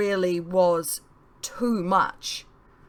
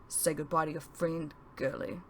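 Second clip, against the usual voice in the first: low, soft threat from a thug; quiet low menace toward the girl; softer, lower villain voice; menacing thug; 3 semitones lower, 9 dB quieter than usual. Acted.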